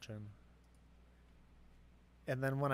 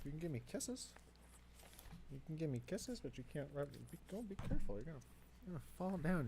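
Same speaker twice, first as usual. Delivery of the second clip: higher cooing voice for the dog; coaxing the dog, then a higher alarmed be careful; 4 semitones higher, faster than usual. Real, not acted. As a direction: sweet-talk the puppy. Baby talk to the dog.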